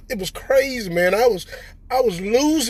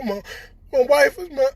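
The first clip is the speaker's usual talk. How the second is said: ordinary delivery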